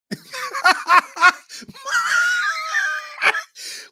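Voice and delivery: high, helpless burst of laughter; big laugh. Real, not acted. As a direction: crack up hard. Laughing at the idea.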